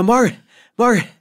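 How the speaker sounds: fast, breathless, high urgent calling of her name; huffing and puffing. Retold or imitated. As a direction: burst in out of breath and call her awake. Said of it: "like, huffing and puffing".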